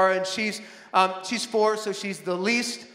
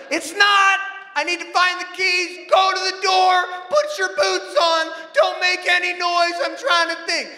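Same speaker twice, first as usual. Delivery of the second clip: put-on higher, louder orders shouted at the kids; stressed shouting; 8 semitones higher, 4 dB louder than usual. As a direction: bark orders like a harried dad. Dad barking orders at kids.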